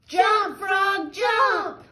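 put-on storyteller leads children in a loud holler. Shown as a demonstration. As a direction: yell it out with the kids. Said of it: "Can you all holler it".

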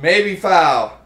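ordinary delivery

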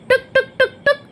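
high, evenly spaced "duk" sounds; playful high clip-clop effect; imitated hoofbeats. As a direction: imitate a trotting horse with the mouth.

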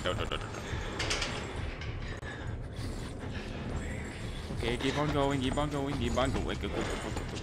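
soft panicked urging; whisper-urge yourself to flee; being chased. Real, not acted. Fleeing monster in game.

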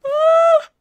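sharp, very high "Ah!"; high loud cry. Real, not acted. Streamer fighting off yawns, crying out in dismay. Cries out after "Oh, no.

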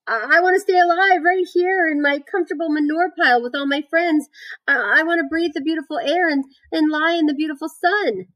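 high, whimpering piglet plea; tearful moaning. A narrator's reading. Wilbur longs to stay alive with his friends, moaning. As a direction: stammer it out in a high wail. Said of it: "he moaned".

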